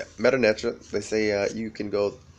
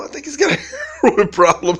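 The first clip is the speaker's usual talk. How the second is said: laughing; 10 dB louder than usual. Real, not acted. Quote broken by laughter.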